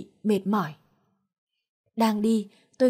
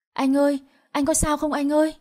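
higher-pitched, mildly whimpering concern; tearful worry; 5 semitones higher, 3 dB louder than usual. A narrator's reading. Girlfriend checks on her knocked-down boyfriend, tearfully worried. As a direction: plead anxiously, on the verge of crying. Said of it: "đỡ gã đàn ông kia và mếu máo".

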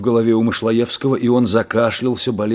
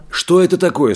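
alarmed question; 8 semitones higher than usual. A narrator's reading. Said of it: "спросил Мышлоевский".

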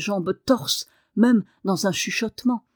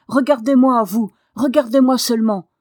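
loud, insistent, defiant shout; cri de défi; 2 semitones higher, 7 dB louder than usual. A narrator's reading. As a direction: insist at full voice that he look. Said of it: "cria-t-il à Ben Staff". (cri fort et insistant)

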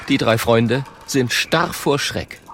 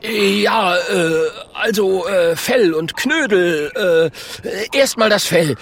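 high, flustered, stumbling caveman; nervous flattery; 9 semitones higher than usual. Acted. Klonk flatters the bear's fur, nervous. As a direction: babble nervously with hesitant ähs.

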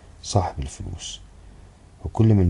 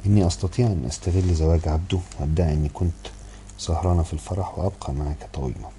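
ordinary delivery

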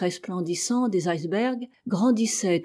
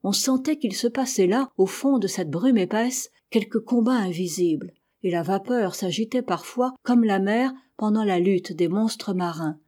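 ordinary delivery, nothing marked

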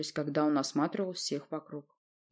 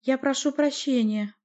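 higher, slow polite interjection; timid; 8 semitones higher, 4 dB louder than usual. A narrator's reading. Boyar addresses the sorceress on the throne, timid. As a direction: cut in politely, a little timid. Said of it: "робко обратился один из бояр".

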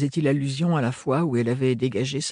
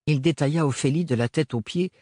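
usual speaking voice

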